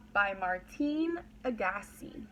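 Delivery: ordinary talk, nothing marked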